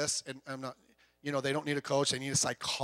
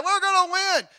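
loud, much higher victory shout; excited cheer; 13 semitones higher, 9 dB louder than usual. Retold or imitated. Players on the sideline sure of the win, cheering loud. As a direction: crow like you've won. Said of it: "sideline certain of win".

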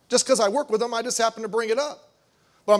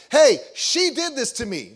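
slow mock child tattling; 3 semitones higher, 4 dB louder than usual. Preacher imitating a child tattling to dad, slow and whiny. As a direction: whine like a kid telling on a sibling. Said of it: "they're just like".

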